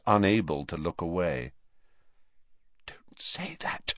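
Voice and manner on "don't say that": breathless pleading whisper. Acted. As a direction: whisper it, begging. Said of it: "he whispered".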